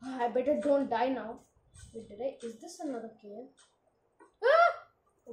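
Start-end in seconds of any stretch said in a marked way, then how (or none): 4.4-5.3 high loud shriek; very high startled yelp; startled scream. Real, not acted. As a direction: scream at a sudden fright. Jump scare in cave.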